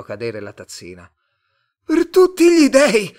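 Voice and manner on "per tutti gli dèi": very high, loud alien cry; piercing alien oath; Martian exclaiming. A narrator's reading. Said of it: "disse il marziano nella sua lingua".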